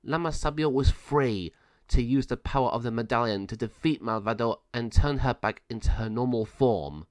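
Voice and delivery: ordinary delivery, nothing marked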